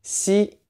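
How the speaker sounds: "Xi" high, between S and SH; tight X sound. Shown as a demonstration. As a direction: hiss "Xi" tightly with tongue forward. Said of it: "it's a tighter sound".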